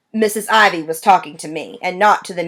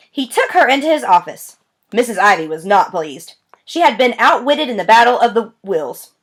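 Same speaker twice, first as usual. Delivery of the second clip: plain reading voice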